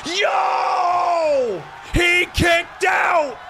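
shouted, hyped wrestling commentary; live play-by-play. Acted. Streamer calls the title match finish for chat, shouting and hyped. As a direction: scream the big moments like a ringside caller.

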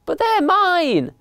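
very high, slow wail of protest; childish protest. A narrator's reading. Young elephant to mice taking his toys, childish protest.

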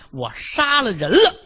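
high, loud, slow confession of murder; the husband confesses murder to his wife, high and loud; anguished confession. Acted. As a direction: blurt the awful truth slowly and loud.

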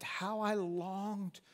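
slow, drawn-out lament; mild sorrowful longing. Acted. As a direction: linger on longed, a touch of sorrow. Jesus weeping over Jerusalem.

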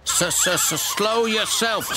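high-pitched, indignant stutter; slow, spluttering high-voiced comeback. A narrator's reading. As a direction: stammer the first sound, then retort. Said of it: "stuttered Percy".

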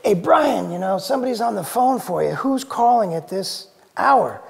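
imitating mom calling loudly from another room; mother calling her son to the phone at four in the morning, loud and high; mom yelling. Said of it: "my mom yells from the back room".

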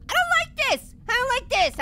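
high-pitched, uneasy complaint; high, jittery objection; nervous protest. Real, not acted. Scared by the game's disappearing figure.

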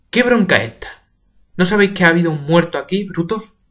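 shouted reprimand; scolding. Acted. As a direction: tell them off. Paca scolding everyone.

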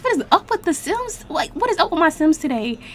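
ordinary talk

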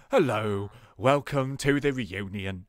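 low, slow villager greeting; classic villager voice. Acted. Villager welcoming a guest to the reunion, classic villager voice. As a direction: put on a classic game-villager voice. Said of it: "like, a classic villager voice".

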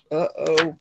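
plain reading voice

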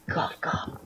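unvoiced spoken coughs as a hint; whispered fake cough. Player hinting at TeamSpeak to teammates, whispered fake cough. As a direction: whisper the word like a sly cough.

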